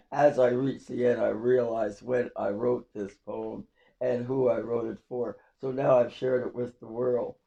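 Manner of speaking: ordinary talk, nothing marked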